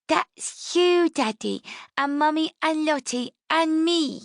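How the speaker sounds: put-on high, bright child's voice, proud of her drawing; little girl voice.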